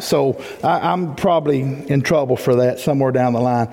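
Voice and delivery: normal talking voice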